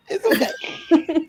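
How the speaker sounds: laughing. Real, not acted. Laughing off being found out.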